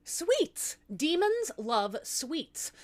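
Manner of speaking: high eager exclaim, then slow; bright idea. Acted. Wife pitching jam for the demon, high and eager. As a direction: sell the idea with delight.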